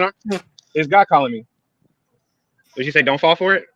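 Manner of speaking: ordinary delivery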